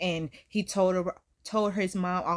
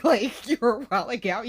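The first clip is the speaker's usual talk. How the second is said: usual speaking voice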